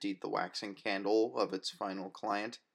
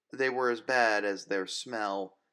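ordinary delivery

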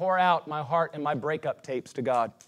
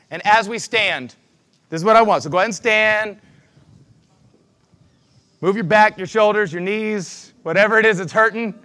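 slow, lifted worship-leader voice; guided prayer; 4 semitones higher, 4 dB louder than usual. Acted. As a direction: lead the room gently into prayer. Leads congregation in prayer.

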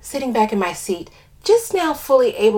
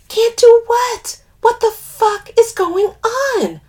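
high, loud, stretched-out demand; alarmed demand; 8 dB louder, slower than usual. A narrator's reading. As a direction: demand answers in rising panic. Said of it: "I demand".

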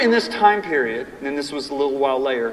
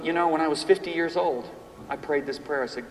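ordinary talk, nothing marked

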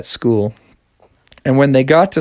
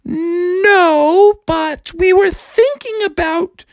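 high, breathy-free lilting princess; slower than usual. Acted. Princess telling Froggy about her sisters, high voice. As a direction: play the upset princess in a high voice. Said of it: "the princesses were like".

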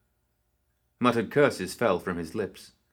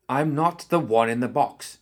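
higher, louder snapped retort; snapping; 5 semitones higher, 4 dB louder than usual. Acted. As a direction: snap it back defiantly. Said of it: "she snapped".

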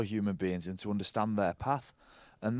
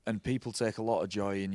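ordinary talk, nothing marked

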